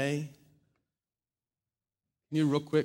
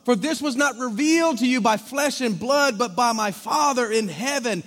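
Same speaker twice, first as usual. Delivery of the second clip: raised, ringing preacher's voice; preaching at full force; 8 semitones higher, 8 dB louder than usual. Acted. Pastor preaching to his congregation, full force. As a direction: preach it out big to the whole room.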